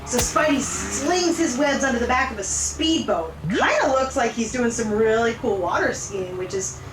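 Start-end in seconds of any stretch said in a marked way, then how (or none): none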